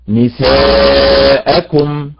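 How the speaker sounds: "نساءكم" drawn out very slowly, each syllable held; stress question. Shown as a demonstration. Teacher models نساءكم slowly for students to find stress. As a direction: stretch "نساءكم" so they can hear the stress. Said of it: "أين النبر فيها".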